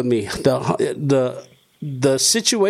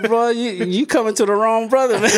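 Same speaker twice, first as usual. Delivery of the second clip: half-laughing, higher tough-guy warning; amused bravado, chuckling; laughing mock warning; 8 semitones higher than usual. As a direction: laugh through a mock threat to the stranger. Retells his wary reply, laughing.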